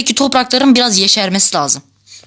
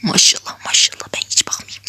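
breathless whisper from a fear of heights; hushed, nervous whisper; scared whisper; 5 dB quieter than usual. Real, not acted. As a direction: whisper it, afraid to look down. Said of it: "Yükseklik korkum var".

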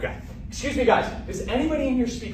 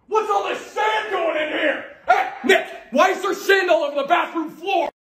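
angry yelling; 9 semitones higher than usual. Acted. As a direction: yell at Nick about the sand. Dad yells about sand.

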